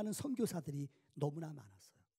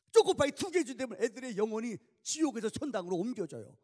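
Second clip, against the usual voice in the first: loud, higher mock-grand punchline; preaching at full force; 6 semitones higher, 10 dB louder than usual. Preacher joking to congregation about soldiers' souls, loud. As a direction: hammer the chocopie joke home.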